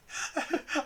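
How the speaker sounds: short laugh; a quick giggle. Real, not acted. Laughing at the scene.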